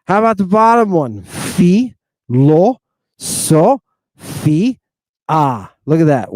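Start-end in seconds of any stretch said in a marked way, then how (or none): 1.2-5.7 one clear vowel in each of "Phi, lo, so, fi, ah"; syllable by syllable. Shown as a demonstration. Teacher showing one vowel per syllable, "Phi, lo, so, fi, ah" separated. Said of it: "One vowel, one vowel, right? Per syllable".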